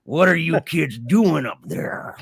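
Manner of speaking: slow, lightly voiced creepy old hillbilly; a hint of a sinister stranger; creepy man voice. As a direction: loom over the kids, menacing and slow. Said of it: "Like this".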